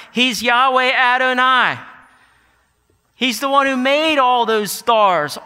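loud, high proclaiming; booming pulpit delivery; full-force preaching. Acted. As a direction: preach it out with fire.

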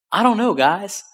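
normal talking voice

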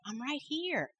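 a quiet voice telling her she need not shout; God's calm answer. Retold or imitated. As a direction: speak as God, hushed and close. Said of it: "and he said".

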